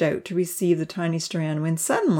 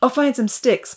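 put-on hurried boy's yell, moderately raised; 6 semitones higher than usual. A narrator's reading. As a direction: call it out higher, mildly urgent. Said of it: "Ponzi yelled".

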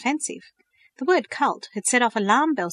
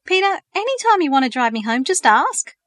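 excited; 7 semitones higher, 7 dB louder than usual. A narrator's reading. Eager offer of a ride.

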